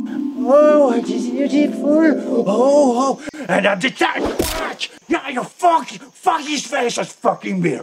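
high, loud whooping and cursing; hammed-up fight frenzy. Instructor acting out beating the bear, hammed-up loud excitement. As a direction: whoop and curse as if landing blows.

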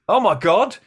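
very high, loud gasp of recognition; shocked realization. Retold or imitated. Luke suddenly realizing who he has been talking to, shocked.

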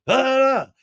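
high loud gasp; a sharp high exclamation; mock outraged gasp. Host imitating Lala's reaction in the fight, high and loud. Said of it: "And Lala was like".

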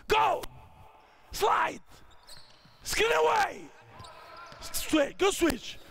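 high, urgent drill commands; calling out. Real, not acted. Coach starting the play, calling out. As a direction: send the calls across court.

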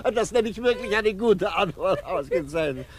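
high, laughing, teasing approval; chuckling sarcastic applause; laughing mock praise. Judge mocking the reverend's wife, laughing high.

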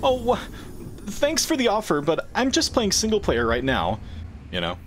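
high, sugary fake-polite voice; high mock politeness to an annoyed kid. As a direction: sound overly sweet and courteous. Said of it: "I was like".

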